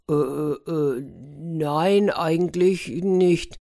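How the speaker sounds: slow, drawn-out awkward denial; hesitant. Acted. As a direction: feel your way reluctantly to a no. Caught off guard by odd question. (zögerlich, gedehnt, verlegen)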